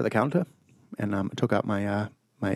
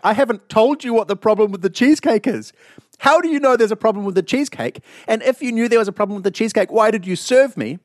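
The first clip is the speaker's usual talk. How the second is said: higher, louder indignant retelling; retold outrage; 9 semitones higher, 4 dB louder than usual. Retelling to co-host how he confronted chef, high and loud indignation. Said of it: "I was like".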